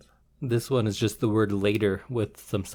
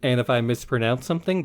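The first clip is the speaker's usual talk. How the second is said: usual speaking voice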